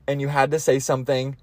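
normal talking voice

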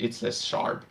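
normal talking voice

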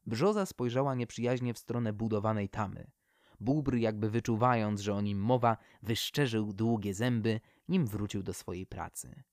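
ordinary delivery, nothing marked

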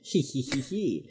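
tiny, hushed sneaky tee-hee voice; mock gleeful giggle. Will standing behind annoyed J J, quietly gleeful. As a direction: say it softly, secretly pleased with yourself. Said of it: "just standing there behind her like".

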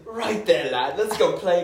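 hammed-up posh British fella; posh British accent. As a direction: invite a lad along in mock posh British. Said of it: "Look at this posh fella".

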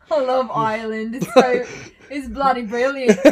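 loud hammed-up British; "bloody brilliant" with a mock British twang; joking cohost, loud fake British accent.

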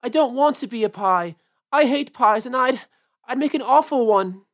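loud, upset little rabbit; loud acted whine of protest; wailing protest. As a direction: keep wailing in a loud character voice. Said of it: "wailed Peter suddenly".

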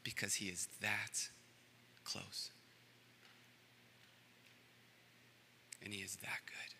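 soft unvoiced whisper. Shown as a demonstration. Preacher to congregation, whispering of God's goodness. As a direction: hush it, intimate and tender. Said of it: "speak to you in a whisper".

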